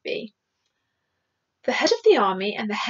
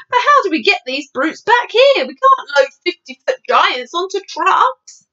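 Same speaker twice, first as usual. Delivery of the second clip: high, loud, exasperated officer; blustering military objection; objecting army chief; 7 semitones higher, 5 dB louder than usual. Acted. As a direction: argue back in a raised voice.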